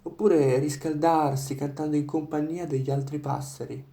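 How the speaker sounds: ordinary delivery, nothing marked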